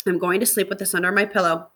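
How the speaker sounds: plain reading voice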